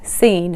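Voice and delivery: Seen, one long EE after a plain S; mistaken pronunciation. Shown as a demonstration. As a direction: repeat the name Sean misread as Seen. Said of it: "thought that this was pronounced as".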